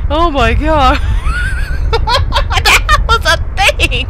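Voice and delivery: high words broken by laughter; amazed, cracking up; laughing hard. Real, not acted. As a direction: try to talk through helpless laughter. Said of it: "I shouldn't be laughing this much".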